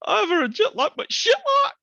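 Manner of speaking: very high cartoonish put-on voice; squeaky high comic impersonation; impression voice. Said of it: "You're taking the comedy too far".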